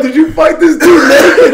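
high, loud mock astonishment with laughter; incredulous cop. Cop laughing at the naked fighter, mock astonished. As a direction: shoot the pitch up in amused disbelief.

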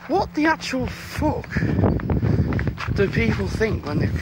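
fed-up walker at pavement parkers; exasperated. Real, not acted. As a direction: drag it out in outraged disbelief. Annoyed at cars on the pavement.

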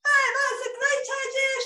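put-on excited girl shout. A narrator's reading. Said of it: "shouted Arabella".

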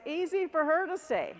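little inside voice. Acted. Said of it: "That little inside voice".

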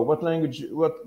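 usual speaking voice

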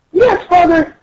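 very high obedient reply; squeaky, eager character voice; dutiful daughter. Acted. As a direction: answer Father as a cartoon daughter. Dutiful daughter answers in the story.

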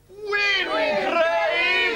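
high, loud cry with hugely stretched vowels; rallying cry. Pretend. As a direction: shout it out long and high like a crowd chant. Catchphrase shouted after "We cry.

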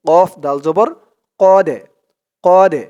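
কদে with the দ pushed out, echoing; loud echoing qalqalah on কদে; bounced qalqalah. Shown as a demonstration. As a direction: give the দ in কদে a hard bounce. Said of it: "এরকম ধাক্কা দিয়ে পড়তে হবে".

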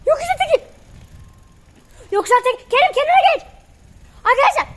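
high loud panicky shouts; panicked shouting. Real, not acted. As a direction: yell in real panic while riding. Riding one-handed downhill, panicking.

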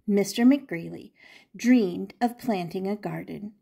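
plain reading voice

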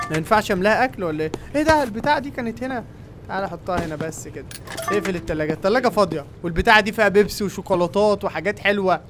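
ordinary delivery

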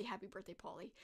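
low, soft robot birthday greeting; hushed movie-robot impression; robot quote. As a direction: lightly imitate a robot, low and quiet. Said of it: "the robot that they give Paulie".

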